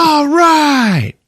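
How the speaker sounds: high, loud acted whoop; triumphant cheer. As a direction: cheer it out with hammed-up joy. Thor celebrates on seeing Hulk.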